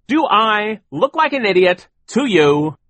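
slow, loud, high rhetorical question; affronted bluster, spaced out; indignant boss. A narrator's reading. Sir indignant at the children.

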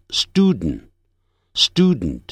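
student as "stood-n", nasal ending; American nasal release. Shown as a demonstration. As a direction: swallow the vowel in "student", release through the nose. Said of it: "喜欢用鼻音啊". (美式鼻音，student念成stud-n)

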